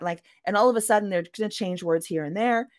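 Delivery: ordinary delivery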